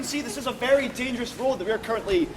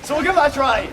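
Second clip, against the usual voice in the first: usual speaking voice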